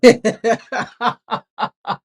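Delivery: loud helpless laughter; cracking up at the chat; hearty laughter. Real, not acted. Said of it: "I can't stop laughing".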